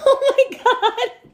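high, laughing disbelief. Real, not acted. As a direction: squeal it through real laughter, drawn out. Amused reaction to Alexa's correct answer.

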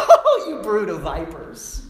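sarcastic sweet scolding; mock gentle rebuke, laughing. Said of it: "That's how he said it".

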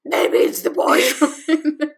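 slow breathy whisper. Acted. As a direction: whisper it like a secret. Joking guesses, whispered aside.

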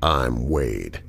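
low, silky, unhurried menace; smooth as cream. Acted. Villain calling James on the hotel line, low and silky. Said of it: "smooth as cream voice".

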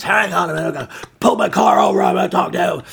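put-on furious girlfriend. Girlfriend pulling the car over to talk, furious. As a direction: imitate her shouting down the phone. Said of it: "she's furious".

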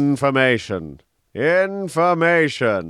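slow, drawn-out villain voice, rising on the repeat; interrogator's demand. Acted. Answers as the show's interrogator.

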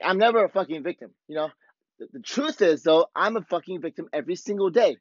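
ordinary talk, nothing marked

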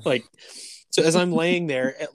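normal talking voice

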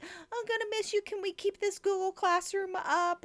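put-on high, childlike plea; high child voice of students pleading; whiny kids voice. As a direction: beg like sad little kids. Voicing sweet students.

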